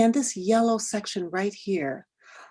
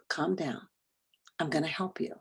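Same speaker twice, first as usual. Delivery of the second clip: much softer, hushed and soothing; calming reassurance; 2 semitones lower, 10 dB quieter than usual. Retold or imitated. Husband or sister calming the lost speaker, hushed and soothing. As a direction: talk someone gently down from panic.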